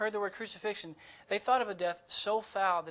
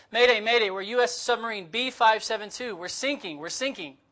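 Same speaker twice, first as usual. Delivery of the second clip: louder, higher desperate distress call from a sinking sub; urgent mayday call; 5 semitones higher, 8 dB louder than usual. Retold or imitated. Submarine captain radios the coast guard, loud, desperate shouting.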